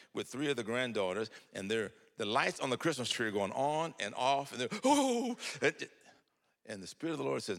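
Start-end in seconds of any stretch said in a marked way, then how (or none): 4.9-5.4 put-on high, loud childlike ooh; delighted children's ooh; imitated awed ooh. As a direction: wonder at the blinking tree lights. Granddaughters marvel at lights.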